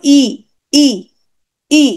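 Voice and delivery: ই held alone, tongue pushed forward; front vowel demo. Shown as a demonstration. Teacher has students repeat along, demonstrating front vowel ই. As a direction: say ই as a clean, separate front vowel. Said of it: "জিভটা একটু সামনের দিকে".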